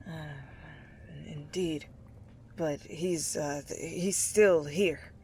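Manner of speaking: mumbling inmate, unsure. Acted. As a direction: mumble it, half hiding. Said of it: "he mumbles".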